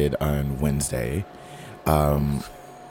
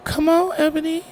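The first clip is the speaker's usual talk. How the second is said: pitched way up, playful protest; high teasing whine. Real, not acted. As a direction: whine it in a squeal. Teasing friend's excuse.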